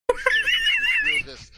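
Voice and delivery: breaking into loud laughter mid-word; laughing. Real, not acted. Player laughs at his own mule joke. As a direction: crack up while talking.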